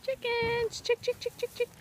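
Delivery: high, soft sing-song chicken call. Real, not acted. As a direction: coax the hens over gently. Calling the chickens over to food.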